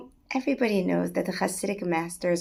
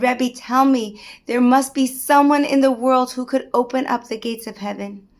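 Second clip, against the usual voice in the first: louder, insistent demand; urgent pleading; 3 semitones higher, 8 dB louder than usual. Acted. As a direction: push him for an answer. Rabbi presses the Maggid.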